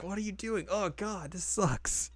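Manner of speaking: put-on a whiny teen complaining under breath; mock Kagome. Imitating Kagome, high whiny complaint at the salt. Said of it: "Kagome's like".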